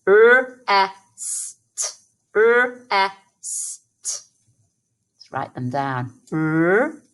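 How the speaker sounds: "R-E-S-T" each sound said separately; sounding out phonemes. Shown as a demonstration. Teacher segmenting rest for children to write. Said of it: "Let's pinch those phonemes".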